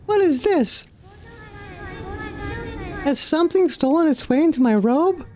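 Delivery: hammed-up giggling sprite; high chuckle of a tiny character. Voicing a game character.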